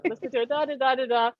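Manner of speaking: normal talking voice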